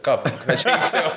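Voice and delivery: high, slow request through laughter; laughing retold plea. Inmate asking the guard for a cell change, retold laughing. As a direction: imitate your own plea, cracking up.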